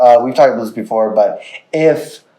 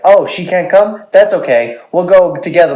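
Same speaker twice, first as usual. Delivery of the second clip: higher, chipper cat voice; Boots; 5 semitones higher than usual. Retold or imitated. Imagining cat happy to go alone with owner, upbeat. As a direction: lightly voice an easygoing pet. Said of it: "Boots will just be like".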